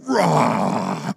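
low, rasping imitated roar; long growled roar; lion roar. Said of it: "a young lion meets you".

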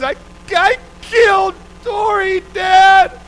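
put-on high, loud wail of a guilty little girl; crying toddler. Little daughter confessing to dad, loud crying wail. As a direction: sob it out like a three-year-old who broke something. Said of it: "And she's literally crying".

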